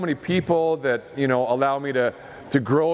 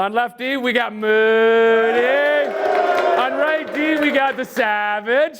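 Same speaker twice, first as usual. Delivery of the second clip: names drawn out and called higher, building to a shout; hype lineup call; 7 semitones higher, 3 dB louder than usual. Real, not acted. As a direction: pump up the locker room name by name. Announcing starting lineup to the team.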